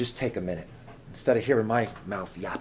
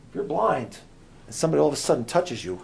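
ordinary talk, nothing marked

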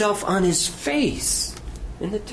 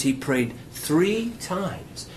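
usual speaking voice

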